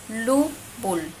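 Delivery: "lubul" broken into lu, then bul; middle Maya B. Shown as a demonstration. Teacher showing B mid-word, "lubul" syllable by syllable. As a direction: sound out "lubul" slowly with a lip-closed B. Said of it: "hacemos un sonido con los labios".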